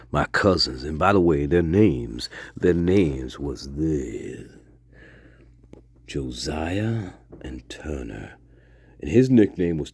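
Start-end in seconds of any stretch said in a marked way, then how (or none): 6.1-8.3 whisper. A narrator's reading. Dramatic reveal of the names.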